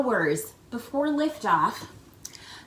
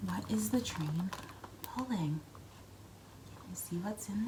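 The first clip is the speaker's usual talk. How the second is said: whisper; 2 semitones lower, 14 dB quieter than usual. Real, not acted. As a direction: whisper it like a secret. Secret peek with flashlight.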